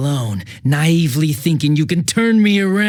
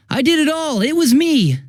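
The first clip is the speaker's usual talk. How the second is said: high, mock-earnest kid's confession; quoting the kid; 11 semitones higher than usual. Detective retelling the kid's confession, mocking it. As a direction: parrot the kid's confession in a higher voice. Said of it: "a kid coming and saying".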